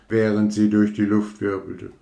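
plain reading voice

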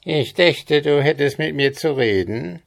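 a hint of a squawky little goblin; quäkender Kobold. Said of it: "hörte er die Quäkstimme des Kobolds". (leicht quäkende Koboldstimme)

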